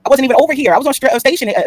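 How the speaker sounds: high, loud protest; emphatic outburst. Real, not acted. Telling viewers she was away, she bursts out loud and high. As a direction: insist hard that she wasn't there.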